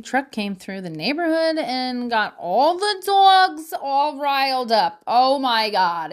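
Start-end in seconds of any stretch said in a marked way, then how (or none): none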